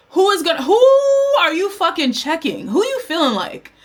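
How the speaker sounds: acted-out who-do-you-think-you-are attitude; indignant challenge.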